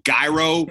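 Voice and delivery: hard g and long i on "gyro"; "gyro" pronounced like guy plus row. Shown as a demonstration. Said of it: "I say, I've said gyro".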